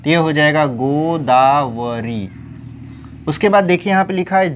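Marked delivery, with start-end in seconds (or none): 0.7-2.3 गोदावरी slowly as गो, दा, व, री; syllable by syllable. Shown as a demonstration. Teacher spelling it out for students, slow गोदावरी. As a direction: hold each syllable of गोदावरी, long ī last. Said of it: "बड़ी ई की मात्रा करना है".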